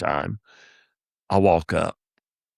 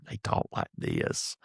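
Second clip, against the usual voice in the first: soft near-whisper, much lower and quieter; mild-mannered murmur; 10 dB quieter, slower than usual. Retold or imitated. Comic mocking the timid openers, hushed whisper. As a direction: mimic timid performers, barely audible. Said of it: "they were very mild-mannered".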